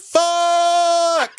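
put-on high, held-out kid's yell; mock gleeful shout. Dad imitates his kid cursing at last, gleeful high yell. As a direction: imitate a child hollering a forbidden word.

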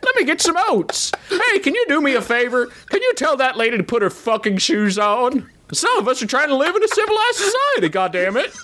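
put-on high cackly old-timer; old prospector voice. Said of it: "Use your old prospector voice".

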